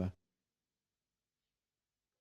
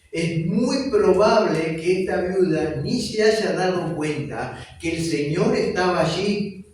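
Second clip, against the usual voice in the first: slow, loud pulpit emphasis; fervent preaching; 4 semitones higher, 5 dB louder, slower than usual. Acted. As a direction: drive each phrase home to the congregation. Preaching at full force. (predicación fervorosa y lenta)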